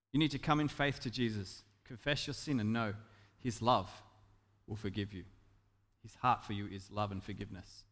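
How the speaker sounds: soft, low, tender assurance; gentle reassurance. Real, not acted. Preacher assuring the fearful of forgiveness, soft and reassuring. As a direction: drop low and soft to comfort them.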